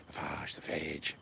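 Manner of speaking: phage first as "fahzh", then as "fayj"; two slow, low guesses at phage, "fahzh" then "fayj"; trying out pronunciations. Shown as a demonstration. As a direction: slowly try phage with "ah", then with "ay". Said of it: "I don't know what the hell they call it".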